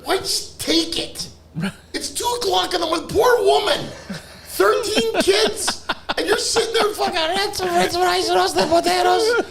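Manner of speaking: high-pitched mock outrage; exasperated scolding. Scolding a friend for troubling a poor woman, high exasperated voice. Acted scolding over a food order.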